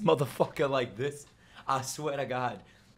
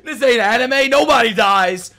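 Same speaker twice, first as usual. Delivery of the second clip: loud, raised-pitch complaint; outraged protest; 6 semitones higher, 6 dB louder than usual. Real, not acted. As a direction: object loudly, voice pushed up.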